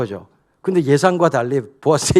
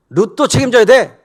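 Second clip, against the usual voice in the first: sudden demand; 7 semitones higher, 6 dB louder than usual. Acted. Boaz springing the condition.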